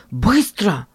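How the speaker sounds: urgent call. Acted. Brother urges action.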